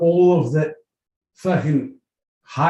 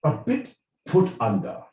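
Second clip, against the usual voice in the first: put-on royal British accent; 5 dB quieter than usual. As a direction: put on a regal British accent, pausing between phrases. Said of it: "in the royal British accent".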